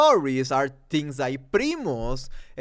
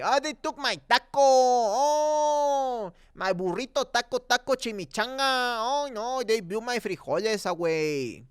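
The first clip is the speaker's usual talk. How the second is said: higher hammed-up fake Mexican accent; 6 semitones higher than usual. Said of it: "with sayings like".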